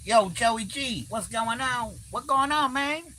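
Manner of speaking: high, slow mock greeting as Mark; imitating Mark. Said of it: "Mark, he always wanted to call me Joey G".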